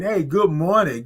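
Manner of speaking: ordinary talk, nothing marked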